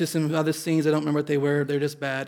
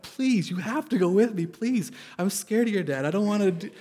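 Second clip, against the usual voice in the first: higher, half-joking frightened begging; mock scared pleading; 5 semitones higher than usual. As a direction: plead like a nervous boyfriend, pitched up. Begging girlfriend to come along.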